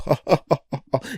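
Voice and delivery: short high villain cackle; Joker cackle. Acted. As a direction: burst into maniacal laughter. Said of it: "laughing like the damn Joker".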